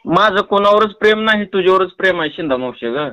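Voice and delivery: normal talking voice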